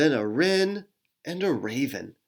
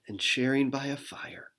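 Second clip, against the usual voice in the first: soft cozy whisper; 6 dB quieter than usual. Acted. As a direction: whisper it gently. Cozy ending of scene.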